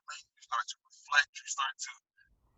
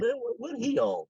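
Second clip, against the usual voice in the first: mocking onlooker; 10 semitones higher than usual. Voicing a doubting onlooker.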